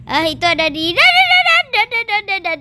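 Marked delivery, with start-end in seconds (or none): 1.0-1.8 bumping noises. Acted. Stroller bumping down stairs.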